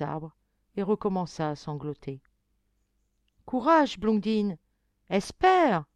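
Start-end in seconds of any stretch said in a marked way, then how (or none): 3.5-5.8 loud high call of encouragement; calling out loudly to the weeping girl. A narrator's reading. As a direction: shout hope across the forest. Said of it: "lui cria encore une voix".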